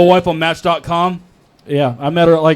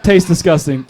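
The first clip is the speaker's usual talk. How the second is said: usual speaking voice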